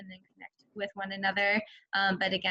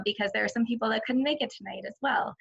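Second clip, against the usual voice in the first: ordinary talk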